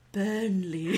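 teasing, dreamy imitation; mock wistful. Host mimicking friend's fondness for a man's town, mock wistful. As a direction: say it as if lost in wanderlust. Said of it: "wanderlust fog over your face".

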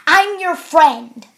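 loud, slow, stern imitation; really stern. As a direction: imitate an officer sternly insisting. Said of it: "he says it really sternly".

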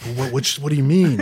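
quick, impatient questioning. Retold or imitated. Boss Rick pressing his employee impatiently. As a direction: rattle it off impatiently. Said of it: "like, impatient".

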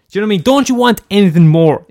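ordinary delivery

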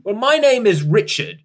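put-on a proud old gent offering his name; old man introduces himself.

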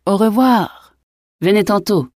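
startled farewell. A narrator's reading. Innkeeper dismissing his companion on noticing the guests, startled. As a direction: call out a startled goodbye, pitched well up. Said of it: "parut alors se réveiller en sursaut et congédia son interlocuteur".

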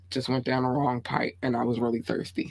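soft unvoiced whisper. Real, not acted. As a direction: whisper it hoarsely after choking. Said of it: "Don't mind my choking earlier".

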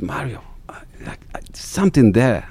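hushed secret whisper; whispering the idea to Mario on the phone; conspiratorial whisper. Retold or imitated.

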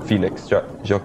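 ordinary talk, nothing marked

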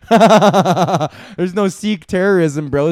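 slow words, laughing as he talks; laughing through it. Real, not acted. Comedian cracking up about Sikhs, laughing through it. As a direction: giggle through the line.

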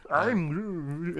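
Swedish Groot. Acted. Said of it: "in a Swedish accent".

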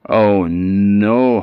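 loud, drawn-out dismay; dismayed realisation. A narrator's reading. Queen remembers the deal she made, dismayed. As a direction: let the horror of it land.

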